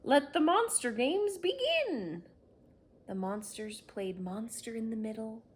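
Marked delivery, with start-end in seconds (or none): 0.0-2.2 high, loud proclamation; grand announcement. Acted. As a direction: call the games open to everyone.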